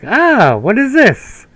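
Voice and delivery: high, curious gasp; mock wonder. Retelling seeing a feather bed, high wonder. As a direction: sound amazed by something new. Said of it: "I was like".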